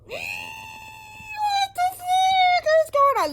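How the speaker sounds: very high, slow, loud cry of disbelief; exasperated yell. Retold or imitated. Reader venting at Lauren with a high, drawn-out yell. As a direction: wail it out at the top of the voice. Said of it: "and I'm going".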